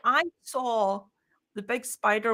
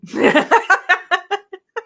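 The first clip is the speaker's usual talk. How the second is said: big high burst of laughter; high, loud laughing fit; 9 semitones higher, 9 dB louder than usual. Real, not acted. Laughing at her own joke.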